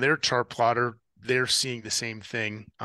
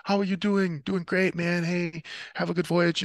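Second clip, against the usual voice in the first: high, easygoing radio chat put on over chaos; mock-casual, higher-pitched cheery check-in; best combat voice, super cool; 8 semitones higher than usual. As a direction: act like everything's totally fine, pitched well up. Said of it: "I'm putting on my best combat voice, right? Like, everything's super cool".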